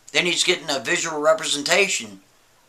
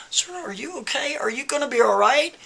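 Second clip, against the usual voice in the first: worried librarian; 8 semitones higher, 3 dB quieter than usual. Retold or imitated. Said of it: "She's like".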